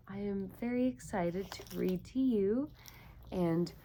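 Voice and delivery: ordinary delivery, nothing marked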